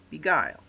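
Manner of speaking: "beguile" with slack "be" and stressed "guile"; stress on second syllable. Shown as a demonstration. Teacher giving an iamb example, "beguile" stressed on its last syllable. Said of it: "followed by a stressed syllable".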